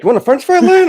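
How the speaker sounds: high mock voice offering a snack; flustered offer. Eighteen-year-old boyfriend coaxing the crying toddler, high mock voice. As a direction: play the panicked teen coaxing a toddler. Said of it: "he's like".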